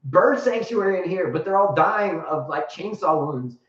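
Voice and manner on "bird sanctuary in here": high startled outburst; a mock astonished rant; retold shock. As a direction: react like you just heard a bird sanctuary. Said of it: "we got on the cover like".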